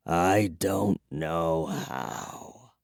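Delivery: very soft, slow, low; weary old vampire. A narrator's reading. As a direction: confess it tiredly.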